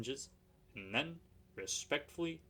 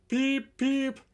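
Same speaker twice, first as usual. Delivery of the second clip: high, loud hoarse whistle; hoarse whistle peep; 13 semitones higher, 11 dB louder than usual. Acted. Exhausted engine whistling to the station, high and hoarse. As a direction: give two strained hoarse peeps. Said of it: "They heard a hoarse".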